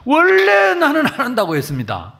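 loud, high defiant outburst; defiant shout. Retold or imitated. Pastor talking back to God, shouting defiantly. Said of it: "그렇게 대듭니다".

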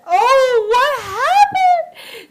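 high, slow crying voice; Mary finding the burnt boy; anguished cry. Acted. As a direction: wail in shock over him. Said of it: "Mary said".

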